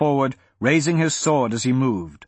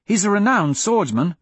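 high-pitched warning, little extra volume; calling after him; 7 semitones higher than usual. A narrator's reading. Arista warns Hadrian about his opponent, calling after him. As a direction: call it out in a higher voice. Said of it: "Arista shouted after him".

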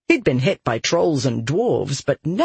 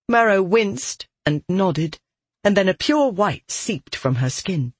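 plain reading voice

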